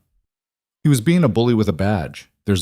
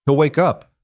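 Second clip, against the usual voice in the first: higher-pitched, mildly confident assurance; understated confidence, pitched up; forced confidence; 6 semitones higher than usual. A narrator's reading. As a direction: say it as if surer than you feel. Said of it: "said it with more confidence than she felt".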